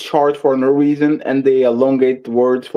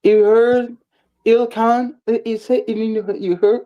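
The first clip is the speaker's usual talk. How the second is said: imitating a Southern accent; 6 semitones higher, slower than usual. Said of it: "If I talk funny, y'all talk even funnier".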